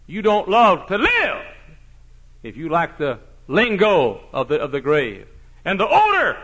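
fiery preaching. Acted. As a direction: hammer it home from the pulpit.

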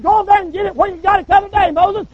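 mock-brisk divine command; commanding Lord. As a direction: rattle off the order like an impatient boss. Lord giving orders.

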